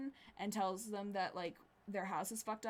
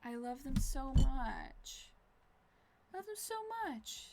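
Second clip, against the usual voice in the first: adoring; 5 semitones higher, slower than usual. Real, not acted. Gushing over show.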